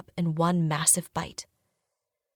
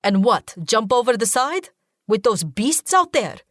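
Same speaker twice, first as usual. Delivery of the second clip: louder, higher mocking retort; sarcastic; 4 semitones higher, 4 dB louder than usual. A narrator's reading. Zahid mocking the plan to jump, sarcastic. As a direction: scoff at the idea. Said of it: "he says sarcastically".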